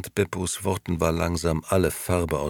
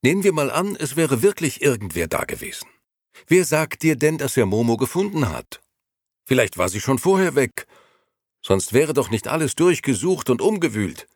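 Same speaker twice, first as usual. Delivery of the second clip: higher, lightly voiced young man explaining; young Gigi, talking it away; 8 semitones higher, 4 dB louder than usual. Gigi arguing with Beppo that Momo may have left, higher. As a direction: reason it away to calm him.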